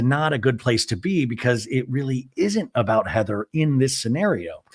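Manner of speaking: normal talking voice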